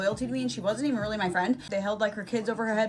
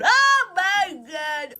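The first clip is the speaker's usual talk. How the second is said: loud, high and stretched out; dramatic exclamation; 6 semitones higher, 5 dB louder, much slower than usual. Retold or imitated. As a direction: draw it out in mock drama. Exclamation while telling her setup.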